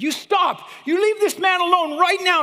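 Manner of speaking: high, loud commanding shout; yelling. Retold or imitated. Student stopping a violent mob, furious commanding yell. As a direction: bellow at the mob to stop. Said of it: "my student yelled at all these people".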